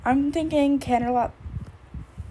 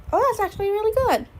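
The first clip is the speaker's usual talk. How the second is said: pleasantly surprised; 9 semitones higher, 3 dB louder, faster than usual. Real, not acted. Gasps at viewer's good design.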